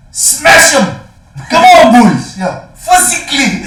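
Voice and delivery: high, loud rugby fan yelling; rowdy fan shouting. Retold or imitated. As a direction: bellow from the stands. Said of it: "he'd be like".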